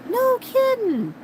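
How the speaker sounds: normal talking voice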